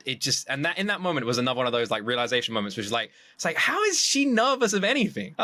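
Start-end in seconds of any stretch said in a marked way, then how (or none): 3.6-5.4 high-pitched disbelieving question; amazed, can't believe it; incredulous. Retold or imitated. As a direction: retell your bafflement, pitch shooting up. Retells his own disbelief at her nerves.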